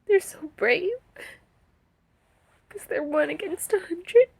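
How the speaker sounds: high, broken phrases between long tearful pauses; crying. Real, not acted. Survivor seeing images of brave soldiers, crying. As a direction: struggle to speak through real sobs, voice pitched high. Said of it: "I'm gonna cry".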